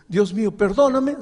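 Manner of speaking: high, loud plea; pleading sinner. Acted. Preacher voicing a sinner begging God, high plea. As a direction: cry out to God.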